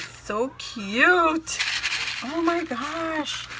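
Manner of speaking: high, slow, drawn-out cooing; thrilled high-pitched gush; gushing delight. Real, not acted. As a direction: squeal it slowly, overcome by cuteness. Gushing over gifted pieces.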